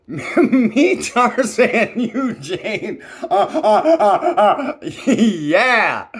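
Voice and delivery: loud, high, laughing caveman Tarzan speak; Tarzan grunts. Retold or imitated. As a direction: hammer out broken jungle-man words while laughing. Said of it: "Sheena of the jungle".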